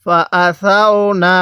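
syllable-by-syllable recitation, measured and drawn out; slow model reading. Shown as a demonstration. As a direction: recite slowly for learners to copy. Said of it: "কিভাবে পড়ব?".

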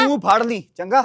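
loud, sharp comeback; angry retort. Acted. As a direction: shout it back at her. Son snaps back at mother.

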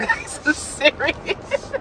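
ordinary delivery

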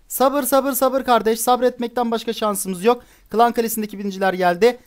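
usual speaking voice

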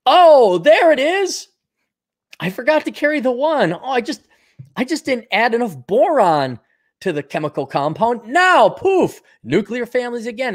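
mock eureka, sarcastic and high. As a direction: fake triumphant discovery. Mock eureka over a failed fix.

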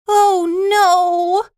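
high, loud wail of dismay; horrified cry. Acted. Horror at tree eating piano.

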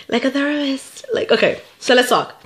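a light hint of a therapist. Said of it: "I feel like a therapist".

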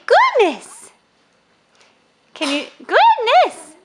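put-on high, loud baby-talk exclamation; playful exclamation. Parent coaxing Elise to talk, high playful exclamation. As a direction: pitch it way up for a toddler.

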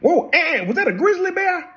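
sudden high startled gasp. Real, not acted. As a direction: jump at the sight of a bear. Startled at bear on screen.

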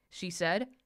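usual speaking voice

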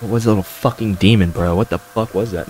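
normal talking voice

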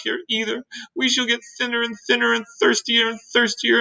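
high, self-pitying melodramatic lament; gloomy earthworm. Acted. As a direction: bemoan your doom as the earthworm.

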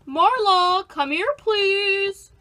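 drawn-out call for Marla. Acted. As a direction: call her in, stretching please slowly. Calling out to Marla.

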